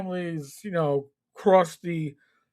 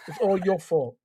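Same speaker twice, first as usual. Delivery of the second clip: New York accent; 4 dB quieter than usual. Shown as a demonstration. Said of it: "in my best New York accent".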